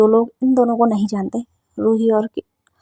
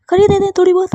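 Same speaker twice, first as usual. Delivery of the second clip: much higher and louder, revved up; fired-up resolve; 8 semitones higher, 5 dB louder, faster than usual. Acted. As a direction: psych yourself up for a fight. Ruhi decides to fight.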